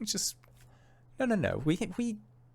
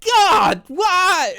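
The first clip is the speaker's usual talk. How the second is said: very high, loud despairing cry; anguished wail; 14 semitones higher, 6 dB louder than usual. Acted. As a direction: throw your head back and lament. Despair after refusal.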